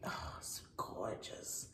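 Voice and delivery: hushed, breath-only admiration; soft unvoiced gasp of delight; awed whisper. Real, not acted. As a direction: whisper it in sheer wonder. Gushing over new bags.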